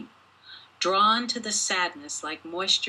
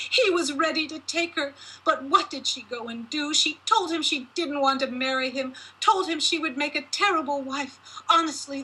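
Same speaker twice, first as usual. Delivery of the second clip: shrill-pitched mother shaming her daughter; raging mother; 7 semitones higher than usual. A narrator's reading. Mother berating her unmarried daughter, in a high raging voice. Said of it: "as she spewed the ugly words".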